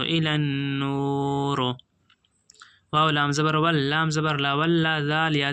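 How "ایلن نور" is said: slow, measured tajweed recitation; slow Quran recitation. Shown as a demonstration. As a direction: draw the words out slowly, chanting. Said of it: "آیت ڈاٹیں گے دوستو".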